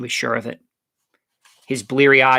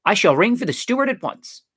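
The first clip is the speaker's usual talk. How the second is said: higher-pitched haughty announcement; lady of rank taking offence at the service; mildly indignant and proper; disapproving; 8 semitones higher than usual. A narrator's reading. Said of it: "she said disapprovingly".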